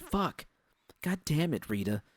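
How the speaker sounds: high, sharp startled outburst; yelping curse at a prank. A narrator's reading. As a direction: jump and swear at the scare. Startled by a sudden bang.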